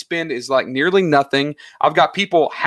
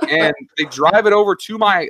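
ordinary talk, nothing marked

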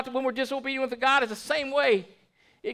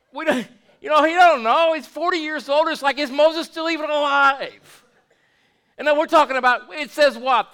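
preaching loud; 4 semitones higher, 7 dB louder than usual. Real, not acted. Preacher builds to loud emphasis.

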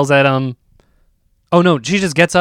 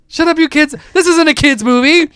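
high-pitched mock outburst; freaking out; 11 semitones higher, 3 dB louder than usual. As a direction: imitate an exasperated friend scolding kids. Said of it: "She freaks out and says".

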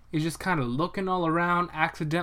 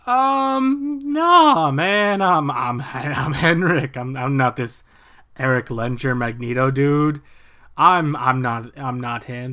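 nervous denial; 5 dB louder, slower than usual. Retold or imitated. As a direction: fumble it like a caught liar. Magneto nervously denying identity.

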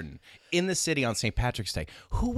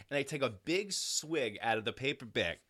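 usual speaking voice

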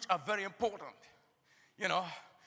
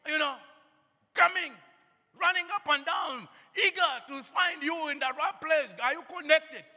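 loud, higher preaching climax; fervent preacher in full cry; preaching at full force; 5 semitones higher, 6 dB louder than usual. Acted. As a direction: preach it out, rising with fervour.

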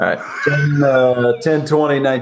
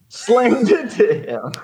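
lightly imitated deadpan comic, higher and slower; a hint of Norm Macdonald's drawl; mild Norm Macdonald impression; 10 semitones higher, slower than usual. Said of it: "Norm Macdonald voice".

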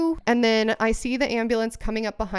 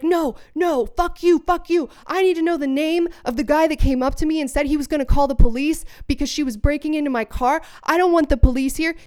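high, loud, agitated retelling; mock irate outburst, pitched right up; acted angry protest; 6 semitones higher, 5 dB louder than usual. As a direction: play a man cursing in outraged protest. Retold man cursing and refusing.

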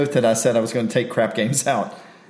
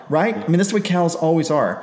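normal talking voice

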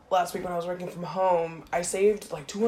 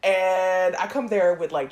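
ordinary delivery

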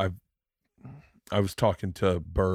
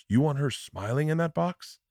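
high-pitched imitation of a disbelieving father; incredulous dad; 7 semitones higher than usual. Dad retold challenging his grieving son at the funeral, incredulous. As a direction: question it as if the idea is absurd.

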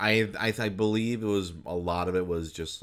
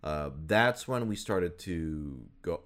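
usual speaking voice

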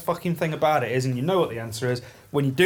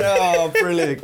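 laughing praise; 2 semitones higher, 4 dB louder, slower than usual. Real, not acted.

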